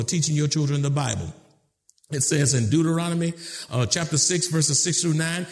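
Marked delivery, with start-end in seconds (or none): none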